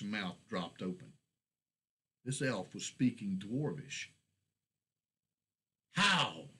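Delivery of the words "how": a shocked, louder sputter; sputtered how. A narrator's reading. Dwarf stunned that the elf speaks Dwarvish, sputtering. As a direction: blurt it out in disbelief. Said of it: "was all he could sputter".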